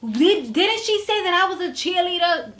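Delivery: Brittany demanding backup, high mock indignation; indignant question. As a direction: ask it high and wounded. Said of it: "She was like".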